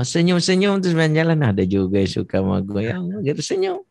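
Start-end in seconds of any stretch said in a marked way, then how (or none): 2.9-3.8 put-on smitten teen.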